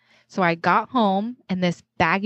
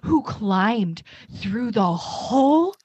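titillated; 11 semitones higher than usual. Retold or imitated. Retold own horrified thought.

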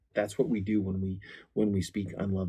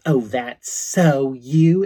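drawn-out, much higher, louder mocking jab; mocking tease; 6 semitones higher, 13 dB louder, much slower than usual. Wife teasing her husband in front of others, slow mocking jab. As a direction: stretch it out like a belittling tease. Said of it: "And she goes".

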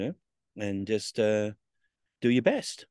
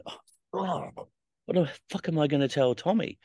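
higher, sinking dread at the news; retold panic over what to tell Tommy; dismayed panic; 7 semitones higher than usual. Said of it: "And I'm going".

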